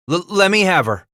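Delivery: high, loud stammered burst; blurted plea. A narrator's reading. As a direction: blurt it out desperately, tripping on the start. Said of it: "Titus blurted".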